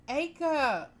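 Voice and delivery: high bright call. Acted. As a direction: call his name joyfully. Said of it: "she called".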